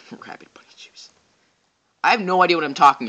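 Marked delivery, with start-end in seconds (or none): 0.1-1.1 whispered through a laugh; barely voiced, breaking into laughter; giggled whisper. Real, not acted. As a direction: say it under your breath, amused. Laughs, repeats own nonsense phrase.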